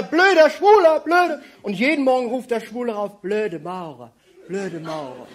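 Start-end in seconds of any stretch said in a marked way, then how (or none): 0.0-1.4 high yelled insult from the scaffold; builders' jeering shout. Acted. Builders on the scaffold jeer at passer-by, shouting high. 3.2-5.3 camp retort. Acted.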